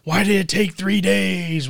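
mock complaining. Co-host imitating people who bitch at him, mock complaining. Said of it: "A lot of people are bitching".

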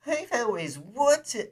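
very high, loud, slow cooing; cooing woman. Acted. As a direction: coo like a pampered lady. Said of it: "she cooed".

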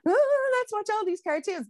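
put-on a high, eager parent pitch to the kids; eager mum. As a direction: gush like a mum selling cartoon night. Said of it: "So it's like".

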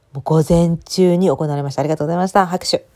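playful mock ceremony voice; mock announcement. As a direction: announce it like a sports day closing ceremony. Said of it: "mock ceremony announcement".

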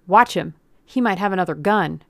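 loud, urgent warning; piping up. A narrator's reading. Sidekick warning his armed partner, piping up loudly. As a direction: jump in with a warning. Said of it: "Swanson piped up".

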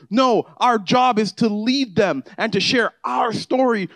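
preaching at full force. Acted. Pastor urging the congregation about transformation, fervent preaching. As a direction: build it up and lift the room.

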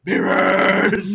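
loud, drawn-out exclamation; mock dramatic cry. Alice announces her vision, mock dramatic cry.

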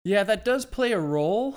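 high, loud, swooping up at the end; exaggerated high-pitched admission, voice rising on role; reluctant concession. Real, not acted. Concedes a point, then restates it.

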